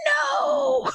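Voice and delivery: long, high wailed no; dismayed wail. Retold or imitated. Coaching volunteer to herself as tears start, high wail. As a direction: cry out a drawn-out, high no.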